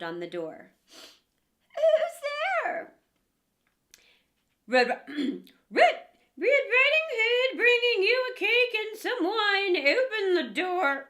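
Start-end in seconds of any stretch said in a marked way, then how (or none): 1.8-2.9 put-on high, loud old woman calling out; old grandmother. Grandmother answers the door. 4.7-11.1 high, loud put-on girlish voice; wolf faking a girl. Wolf fakes the girl's voice.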